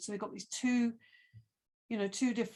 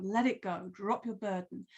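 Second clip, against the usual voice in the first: ordinary delivery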